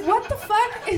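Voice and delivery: high, loud shocked outburst. Retold or imitated. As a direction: react to the package in shock. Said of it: "I was like".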